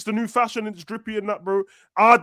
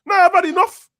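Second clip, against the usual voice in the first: louder, much higher exasperated shout; fed-up rant; 10 semitones higher, 6 dB louder than usual. Real, not acted. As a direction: blow up as if you've had enough. Fed-up rant about players' outfits.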